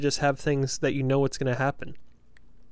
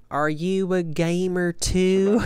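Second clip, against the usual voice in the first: mom voice; 4 semitones higher, 4 dB louder, much slower than usual. Retold or imitated. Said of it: "she goes".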